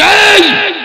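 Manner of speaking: sharp high shout of এই; a husband startling his wife; angry call. Acted. Sick husband shouting at his wife, angry.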